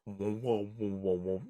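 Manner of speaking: indistinct wordless murmur, dropping low and soft; muffled speech through door. Shown as a demonstration. Game master showing voices through a thick wooden door, muffled. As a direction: fade into low, muffled wah-wah sounds. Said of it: "Now all you hear is a".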